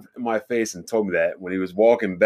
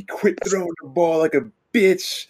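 high-pitched retold dad's scolding; raised-pitch mock coach tirade; imitating yelling dad; 8 semitones higher than usual. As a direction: mimic a hard-ass father barking orders. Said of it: "yelling at me".

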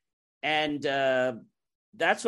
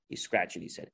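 normal talking voice

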